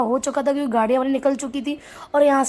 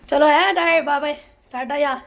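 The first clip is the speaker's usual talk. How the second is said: loud, raised farewell; called-out goodbye; 4 semitones higher, 6 dB louder than usual. Real, not acted. Aunt leaving the house, calling out goodbye. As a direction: call your goodbyes out as you leave.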